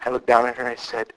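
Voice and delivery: usual speaking voice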